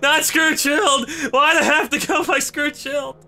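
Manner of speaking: high, loud, drawn-out lament; anguished wail. Real, not acted. Gamer wailing as his pieces scatter. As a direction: wail over your loss. Getting split apart.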